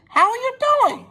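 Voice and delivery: very high, light little-engine voice; high Rosie voice. Acted. Rosie greeting Donald, mistaking him for Douglas, very high and light. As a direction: squeak it out like a small cheerful engine.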